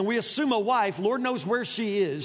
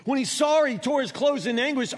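ordinary talk

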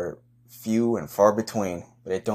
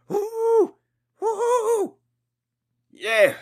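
high falsetto woo, shouted out; whooping cheer; much slower than usual. Acted. Pumped fan hyping the Bears in the parking lot, whooping. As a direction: whoop like a crazed tailgater. Tailgate hype before the Bears game.

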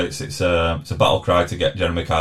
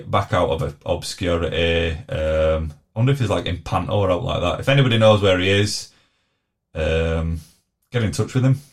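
usual speaking voice

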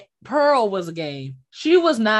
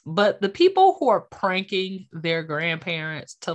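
ordinary talk, nothing marked

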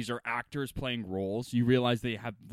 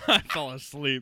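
slower, laughing through it; laughing repeat; 5 dB louder than usual. Real, not acted. Laughing to friend about dozing off in movie. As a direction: chuckle the words out, a bit drawn.

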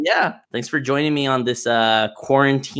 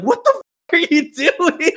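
high, slow, incredulous mock cry; imitated disbelief; 11 semitones higher than usual. Retelling the babysitter watching the child run off, mock disbelief. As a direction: stretch it out, laughter breaking through.

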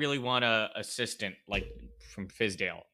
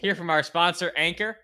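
hyped announcer; 8 semitones higher, 7 dB louder than usual. Acted. Ad break announcement.

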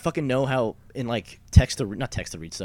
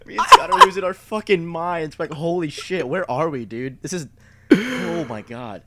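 higher, excited and laughing, can't believe it; laughing disbelief; 8 semitones higher, 5 dB louder than usual. Real, not acted. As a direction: stretch the last oh my God out slowly in giddy shock.

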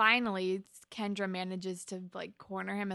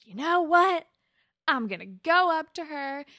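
loud, higher burst of resolve; resolved; 7 semitones higher, 9 dB louder than usual. Retold or imitated. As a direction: psych yourself up to go. Toby gathers courage.

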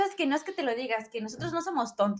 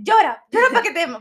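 mock mother scolding; 5 semitones higher, 11 dB louder than usual. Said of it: "te pegaban y te decían".

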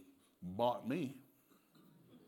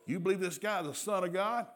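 high-pitched, incredulous interrogation; grilling; 8 semitones higher, 4 dB louder than usual. Acted. Roman soldiers grilling the blind man, interrogating. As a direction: grill him with a raised voice. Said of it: "they grilled that blind man".

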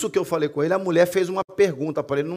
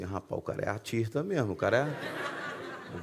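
much softer, lower private aside; thinking to himself; 6 semitones lower, 12 dB quieter, slower than usual. Retold or imitated. Pastor privately judging the crying man, hushed aside. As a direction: drop it to a low, amused inner remark. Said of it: "eu pensei assim".

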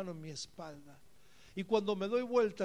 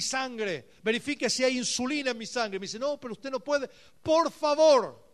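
louder, driving sermon voice; fervent preaching; 3 semitones higher, 9 dB louder than usual. Real, not acted. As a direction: press the story hard. Preaching at full force.

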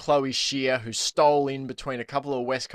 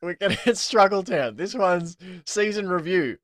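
laughing announcement; 7 semitones higher, 4 dB louder than usual. Real, not acted. Joking name Struggle Town.

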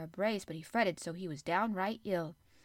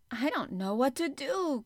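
higher, fretful outburst; agitated cry; 6 semitones higher, 4 dB louder than usual. A narrator's reading. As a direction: wring your hands and cry it out. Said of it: "cried agitated Martha".

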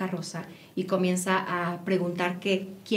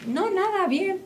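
put-on child's reply; 11 semitones higher, slower than usual. Daughter's curt reply voiced.

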